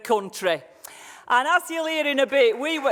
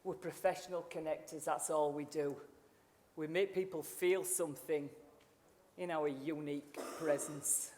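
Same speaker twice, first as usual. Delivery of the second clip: much softer, lower, drawing the room in; intimate low point; 6 semitones lower, 12 dB quieter than usual. Acted. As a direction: hush it like a secret you trust them with. Softly guides audience reflection.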